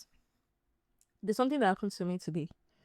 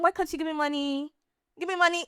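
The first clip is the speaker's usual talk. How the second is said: a higher pleading beggar voice, insistent; pushy beggar imitation; 6 semitones higher, faster than usual. Vlogger mimics a London beggar demanding money, higher and insistent. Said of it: "you're like".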